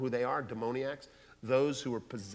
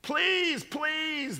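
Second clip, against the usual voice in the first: high, thin begging demons; pleading demons; 13 semitones higher than usual. Acted. Demons begging Jesus for mercy, high and pleading. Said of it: "the demons say".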